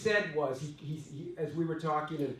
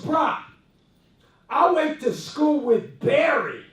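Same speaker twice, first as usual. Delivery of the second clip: retold shout; 9 semitones higher, 8 dB louder, slower than usual. Friend's boast, shouted.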